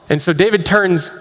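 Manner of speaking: ordinary talk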